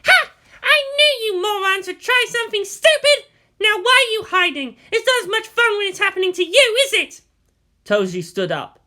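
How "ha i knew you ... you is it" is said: high, loud mocking girl voice; mocking Asuka. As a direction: jeer down at the peeping boys. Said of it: "Asuka's biting laughter".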